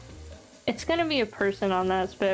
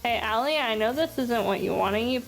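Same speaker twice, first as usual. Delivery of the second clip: ordinary talk, nothing marked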